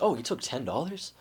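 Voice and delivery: lightly acted surprise; mock surprise. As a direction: react as if just noticing you were shortchanged. Imagined player sees money taken.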